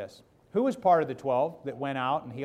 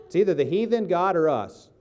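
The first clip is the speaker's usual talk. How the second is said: forceful preaching; 4 semitones higher, 7 dB louder than usual. Real, not acted. Pastor pressing the congregation.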